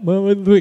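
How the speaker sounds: "me-" broken off and jumbled; tongue-tied stumble. Shown as a demonstration. Trainer showing a hard word going wrong, stumbling on "me-". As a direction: trip over "me-" as if tongue-tied. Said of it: "jadi beku".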